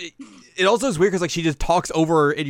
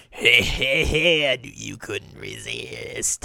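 put-on gloating voice, drawn out; hammed-up pleased-with-himself teasing; gleeful little character; much slower than usual. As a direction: chuckle through the heys and stretch the ending. Acts out Myoga's gleeful greeting.

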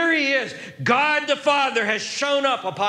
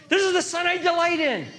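high, quickened joyful proclamation; exultant, higher-pitched declaration; God's delight; 6 semitones higher, faster than usual. Acted. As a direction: burst out with pride in a son. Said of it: "Here he's describing a delight".

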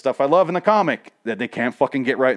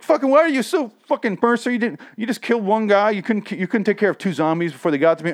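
much higher, prissy whining complaint; prissy whiny prince; 11 semitones higher, 3 dB louder than usual. Retold or imitated. Spoiled prince whines at his security head, much higher and prissy. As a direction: whine like a spoiled rich boy at his guard. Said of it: "this is how fucking prissy he is".

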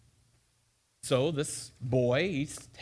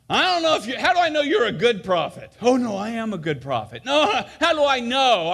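put-on suspicious kid; 6 semitones higher, 5 dB louder than usual. Bold kid questions prophet.

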